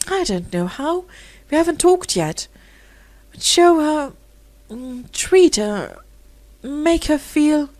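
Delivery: high, tumbling, groping for words; eager pleading. Acted. Rosemary urging Philip to be kind, eager. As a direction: plead breathlessly, rising high.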